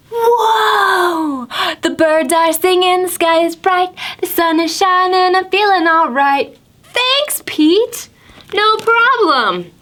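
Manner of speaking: high shouted whoa, then a happy lilt; excited turtle. Acted. Turtle seeing the world through magic sunglasses, high and thrilled. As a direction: burst out in wonder.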